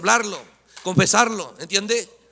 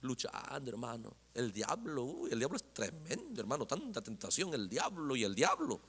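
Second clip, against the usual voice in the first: low, very soft, defeated complaint; downtrodden struggling brother; 6 semitones lower, 13 dB quieter than usual. Acted. Struggling believer answers a greeting, low and weary. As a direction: murmur it like a weary, beaten believer. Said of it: "tú le preguntas, "Hermano, ¿cómo estás?".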